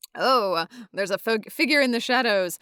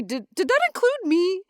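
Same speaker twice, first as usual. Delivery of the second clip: nervous high voice; 12 semitones higher, 3 dB louder than usual. Acted. Nervous Groose question.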